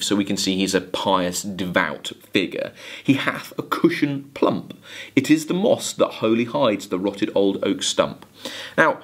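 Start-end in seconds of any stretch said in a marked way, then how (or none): 3.0-8.3 a steady, measured recitation of a ballad; poem reading. Acted. Teacher reading Coleridge to students, measured poem recitation. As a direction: read the verse to the class with care.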